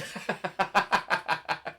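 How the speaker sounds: high burst of giggles; real laughter. Real, not acted. Amused by the song's refrain, laughing. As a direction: crack up after the refrain. Cracks up after hey refrain.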